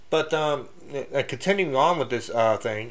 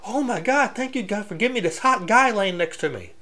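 a high mock woman's voice, gushing; imitating a woman; 8 semitones higher than usual. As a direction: lightly put on a woman's voice, thrilled. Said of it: "in the women's point of view".

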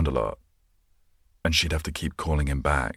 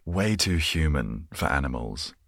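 ordinary talk, nothing marked

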